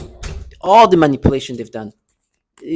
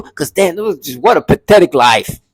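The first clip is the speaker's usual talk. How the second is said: ordinary talk, nothing marked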